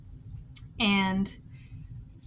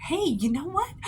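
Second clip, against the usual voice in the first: bright, higher burst of discovery; sudden idea; 8 semitones higher than usual. Real, not acted. Vlogger struck by a new stamp idea, excited. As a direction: catch a new idea mid-thought.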